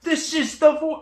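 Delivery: high, loud burst of excitement; excited discovery. Real, not acted. Announcing the found voice of the car, excited. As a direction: announce it loudly, thrilled, drawing out the words. Thinks they found the car's voice.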